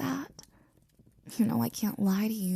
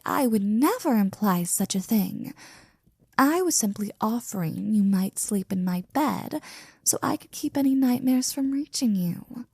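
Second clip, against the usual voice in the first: ordinary delivery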